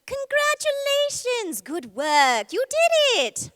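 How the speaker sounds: high, drawn-out British alien cheer. Acted. Actor in body paint greeting runners in a high British alien voice. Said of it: "She's a British alien".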